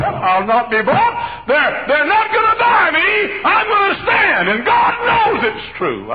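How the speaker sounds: slow, very high, very loud preaching shout. Real, not acted. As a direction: cry it out to the whole church. Climax of sermon.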